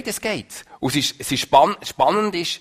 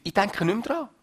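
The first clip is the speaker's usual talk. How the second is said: mild assurance; 8 semitones higher than usual. Acted. God's words, first milder take.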